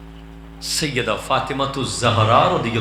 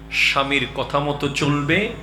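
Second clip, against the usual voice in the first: ordinary delivery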